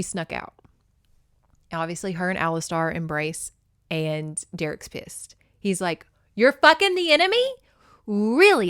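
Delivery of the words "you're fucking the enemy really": higher, louder, fast mock fury; retold angry yell, hammed-up; angry outburst. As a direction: hurl the accusation at Alistar. Derek pissed at Alistar.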